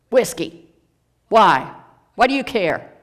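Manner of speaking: put-on loud, defensive drunken reply; gruff drunk. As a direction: bark it back at the old man.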